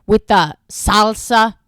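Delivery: put-on loud, slow dad-style Spanish accent; overdone tourist Spanish accent; mock Spanish accent. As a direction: draw it out with fake Spanish flair. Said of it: "English words in a Spanish accent".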